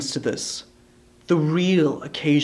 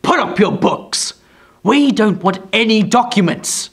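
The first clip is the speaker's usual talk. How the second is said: raised, curt dwarf; lightly voiced scornful dwarf; 6 semitones higher, 5 dB louder than usual. A narrator's reading. Quilp dismissing Brass's documents, scornful. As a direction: brush the lawyer off.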